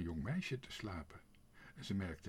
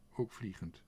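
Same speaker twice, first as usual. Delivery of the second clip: ordinary delivery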